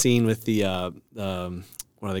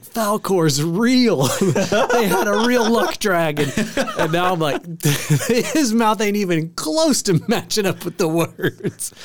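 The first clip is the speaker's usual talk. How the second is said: laughing; 8 semitones higher, slower than usual. Retold or imitated. Retelling childhood belief, laughing.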